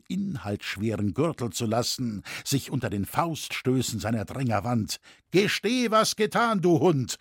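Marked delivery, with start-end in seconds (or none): 5.3-7.2 high, raised accusation; angry shouting. A narrator's reading. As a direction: demand a confession from the culprit. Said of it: "schrien sie".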